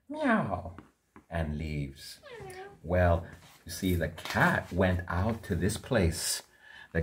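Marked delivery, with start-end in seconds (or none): 0.1-0.8 a high, soft little cat meow. Acted. Storyteller meowing like the cat to the children.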